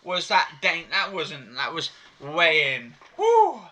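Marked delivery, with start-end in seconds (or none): 3.2-3.6 high loud whoop; excited yell of triumph; cheering woo. Real, not acted. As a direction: celebrate out loud. Cheering the stop.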